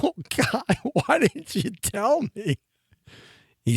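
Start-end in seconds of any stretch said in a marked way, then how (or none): 0.0-2.6 much higher, laughing, slowed protest; laughing disbelief. Retold or imitated. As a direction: laugh through the disbelieving question. Laughing disbelief at mentor's reveal.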